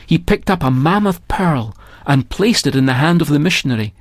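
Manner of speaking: ordinary delivery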